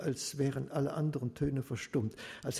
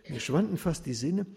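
ordinary delivery

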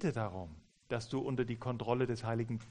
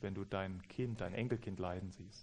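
very soft, low sympathy; lowered voice; 3 semitones lower, 7 dB quieter than usual. Retold or imitated. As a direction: quietly acknowledge the pain. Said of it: "meine Stimme gesenkt". (leise und mitfühlend)

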